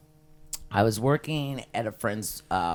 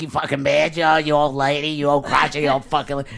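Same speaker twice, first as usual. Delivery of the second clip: mock angry rant; 2 semitones higher, 7 dB louder than usual. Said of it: "you're thinking all this stuff".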